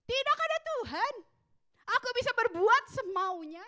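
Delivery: high, loud brash boast; a rebellious son defying God, loud and arrogant; defiant arrogance. Retold or imitated. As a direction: sneer at God like a reckless son. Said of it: "berkata begini".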